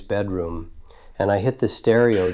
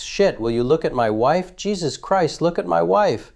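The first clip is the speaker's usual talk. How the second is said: frantic plea; 8 semitones higher than usual. Retold or imitated. Retelling frantic plea at crime scene.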